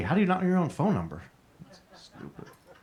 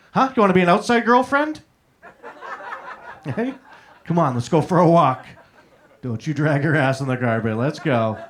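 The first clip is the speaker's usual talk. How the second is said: talking to a dog; 4 dB louder than usual. Pretend. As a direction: coax her out like a pet on a walk. Talks to girlfriend like dog.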